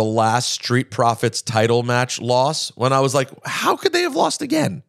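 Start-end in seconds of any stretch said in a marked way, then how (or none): 3.5-4.8 high-pitched, incredulous outcry at no extra volume; mock-distressed high voice; retold exasperated disbelief. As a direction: re-enact a fan's baffled exasperation, pitched way up. Retelling own reaction to a loss.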